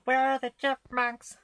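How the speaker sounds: very high squeaky sung chipmunk voice. Pretend. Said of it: "[name] in a chipmunk voice".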